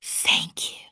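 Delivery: soft breathy whisper of thanks. A narrator's reading. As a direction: whisper it gently to the horse. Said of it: "I whisper".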